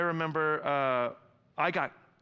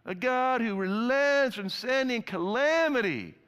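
slow, higher, scornful reading of Jonah's prayer; disdainful; 6 semitones higher, slower than usual. A narrator's reading. Pastor reading Jonah's bitter prayer to God, slow and scornful. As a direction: let the disdain drip from every phrase. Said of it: "You can just feel the disdain here".

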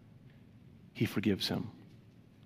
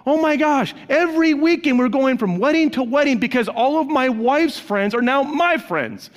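mock exasperation; 11 semitones higher, 5 dB louder than usual. Retold newlywed exasperation.

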